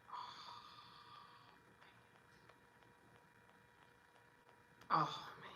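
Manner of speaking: breathless whisper of dismay; hushed, dismayed whisper; whispered oh man. Retold or imitated. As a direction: whisper it like a sorry sigh. Reacting to patient's weight regain.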